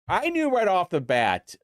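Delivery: ordinary talk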